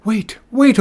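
high, loud urgent call; urgent narrated shout; shout from afar. A narrator's reading. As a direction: call out to stop him. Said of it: "he heard a shout from the dock".

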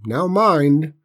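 aunt's called-out order; calling out. A narrator's reading. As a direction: toss a firm reminder back. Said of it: "she called over her shoulder".

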